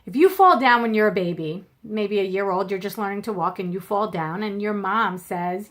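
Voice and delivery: normal talking voice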